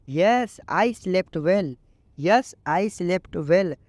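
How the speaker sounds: very slow, each English word laid out clearly; slow teaching read. Shown as a demonstration. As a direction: model the sentence slowly for learners. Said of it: "तो आप इसको English में बोलो".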